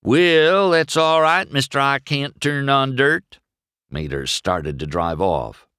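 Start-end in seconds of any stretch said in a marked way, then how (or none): 0.0-3.4 loud, high-pitched country-buddy taunt. A narrator's reading. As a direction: needle a pal with a mock title. Mater taunts McQueen.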